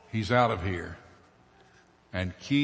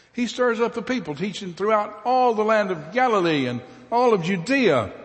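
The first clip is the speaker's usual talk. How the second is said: high, loud, strange-voiced outcry; a fierce mob shouting at Pilate; 10 semitones higher, 4 dB louder than usual. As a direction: roar the charge at the governor. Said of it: "The mob was fierce".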